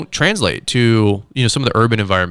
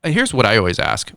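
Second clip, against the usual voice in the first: usual speaking voice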